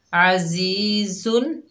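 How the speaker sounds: अजीजुन with clear un-nasalised नुन, very slow; izhar demo. Shown as a demonstration. Teacher showing students izhar halqi, slow recitation. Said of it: "तनवीन में इजहार होगा".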